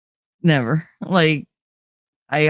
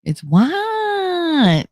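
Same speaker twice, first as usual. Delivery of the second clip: "what" stretched long and high in Jersey accent; exaggerated Jersey "what"; 11 semitones higher than usual. Shown as a demonstration. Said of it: "It's not what".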